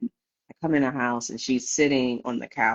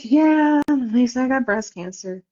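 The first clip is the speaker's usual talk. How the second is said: nonchalant; 8 semitones higher than usual. Retold or imitated. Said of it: "All nonchalant".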